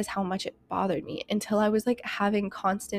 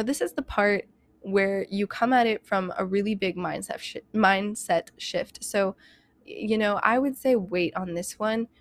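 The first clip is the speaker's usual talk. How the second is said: ordinary talk, nothing marked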